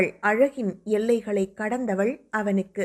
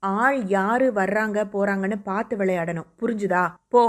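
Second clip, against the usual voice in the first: plain reading voice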